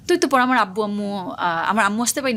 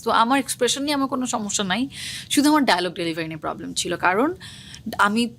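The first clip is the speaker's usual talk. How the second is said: ordinary delivery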